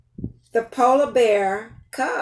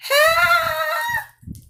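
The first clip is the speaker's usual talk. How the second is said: high squeak. Acted. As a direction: squeal like a tiny bush baby. Making a baby animal sound.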